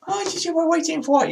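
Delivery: high, soft mocking English voice; mock English crowd. Host mimics the English crowd on baby watch, mock English accent. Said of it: "they're all talking like this".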